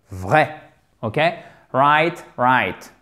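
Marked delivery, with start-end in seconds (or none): 1.7-2.9 Right said rait, rising then falling; rait sound. Shown as a demonstration. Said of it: "Même prononciation".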